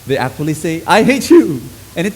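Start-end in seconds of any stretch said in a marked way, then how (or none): 0.9-1.6 higher and louder, spat out; mock yell of hatred; hateful shout. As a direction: shout it with spite. People shouting hate.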